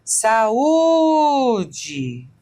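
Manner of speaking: "saúde" with the u held long, high and loud; hiatus in saúde. Shown as a demonstration. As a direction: split "saúde" as sa-UUU-de, stretching the u. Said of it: "quando a gente diz saúde".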